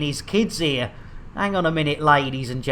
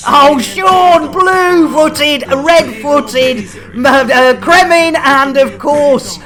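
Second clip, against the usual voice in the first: loud, high, excited shout-out to viewers; excited greeting; 8 semitones higher, 8 dB louder, slower than usual. Real, not acted. Streamer loudly greeting regulars in chat, excited shout. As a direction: call out the names with big cheer.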